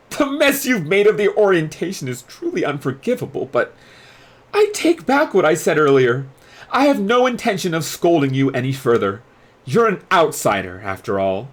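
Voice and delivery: a strict prefect who decides to let it go; stern student leader. Acted. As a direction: dorm leader scolding the outsider, then relenting. Riddle, stern then relenting.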